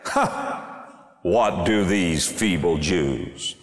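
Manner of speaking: biting, withering mockery in slow, drawn-out questions; dripping with contempt, dragging out the words; scornful sarcasm. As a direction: sneer each slow question as a taunt. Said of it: "Can you hear the sarcasm?".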